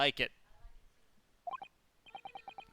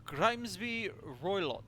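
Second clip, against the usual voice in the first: put-on "Grimsby Roylott" slow, louder, rolled Russian accent; 4 semitones higher, 5 dB louder, slower than usual. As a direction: give "Grimsby Roylott" a heavy Russian weight, slowly. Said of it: "sorry to literally anybody who actually has a Russian accent".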